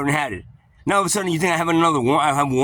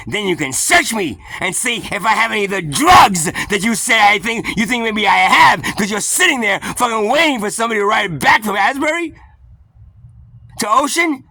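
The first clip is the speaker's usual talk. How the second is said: loud, raised-pitch tirade; angry accusation; 5 semitones higher, 5 dB louder than usual. Retold or imitated. Accusing the officer of waiting to search him for drugs, angry. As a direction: hammer out the accusation, getting louder. Ranting at officer about profiling.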